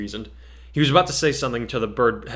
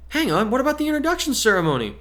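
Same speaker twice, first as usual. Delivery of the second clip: put-on much higher, insistent girl's voice; girl demanding; 13 semitones higher than usual. Girl pressing the teacher about the ceremony, high and insistent. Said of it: "the brown-haired girl ... demanded".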